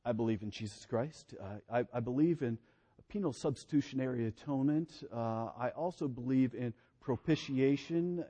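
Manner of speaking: low, soft, rote listing of beliefs; dry recited creed. Retold or imitated.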